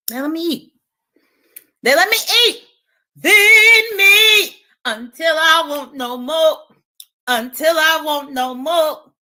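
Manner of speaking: high, loud, slow drawn-out chant; Jay Wilson voice. Retold or imitated. Vlogger eating lemon doing Jay Wilson's voice, high slow chant. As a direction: stretch it high and slow like a preacher. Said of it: "In my Jay Wilson voice".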